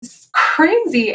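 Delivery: slow, rising amazement; savoring the shock of good news; amazed disbelief. Retold or imitated. Singer telling interviewer, stunned by the record deal. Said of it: "I'm like".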